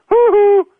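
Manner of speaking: high strangled "Hoo hoo" while faking a choke; a high, squeezed hoot; high choked hoot aimed at her in the mirror; choking hoo hoo. Acted. Bus driver mock-choking again.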